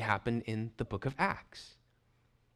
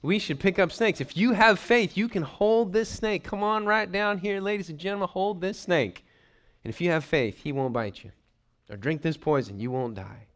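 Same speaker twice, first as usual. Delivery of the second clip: mock snake-handling preacher; 5 semitones higher than usual. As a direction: rouse the congregation like a tent-meeting preacher. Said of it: "they use this to say".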